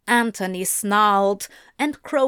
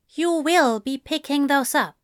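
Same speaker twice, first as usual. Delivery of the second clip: higher, firm instruction; firm order; 5 semitones higher than usual. A narrator's reading. Zira orders Crowley to pick up fallen books, firm. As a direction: make it a command with no room for refusal. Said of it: "It wasn't a question".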